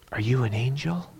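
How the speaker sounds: soft, hushed acted question; hushed awe. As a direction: ask it softly, imitating someone in wonder. Retold awestruck question at door.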